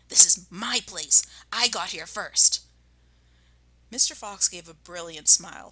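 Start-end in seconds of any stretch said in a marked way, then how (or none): 0.1-2.6 louder indignant rat voice; Rat shrieking. Acted. Rat refusing to leave his spot, shrieking. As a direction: squeal back defiantly. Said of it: "shrieked Rat".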